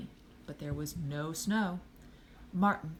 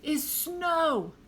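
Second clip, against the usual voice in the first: high, loud, indignant punch; high exasperated shout; disgusted yell; 10 semitones higher, 5 dB louder than usual. A narrator's reading. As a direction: hammer it home in disgust. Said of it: "gave her a disgusted look and yelled".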